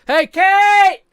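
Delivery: loud, high call across the house; yelling for Kate. Real, not acted. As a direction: shout for her in another room. Calling wife from other room.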